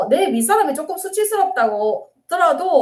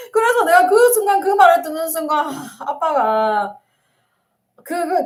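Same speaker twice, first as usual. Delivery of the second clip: fighting back tears; choked up; 3 semitones higher than usual. Real, not acted. Counselor recalling her father, voice close to tears. Emotional recollection, cry heard.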